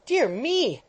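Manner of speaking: a startled little cry. Acted. Pickwick startled by the boy's face, startled. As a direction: jump at the words as if caught off guard. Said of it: "said Mister Pickwick, starting".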